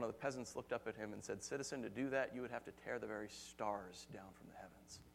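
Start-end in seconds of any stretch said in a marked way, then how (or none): 1.4-5.0 low, soft, understated reply; quiet retort. Retold or imitated. Peasant answers the yelling revolutionary, quiet retort. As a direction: answer the shouting calmly and quietly.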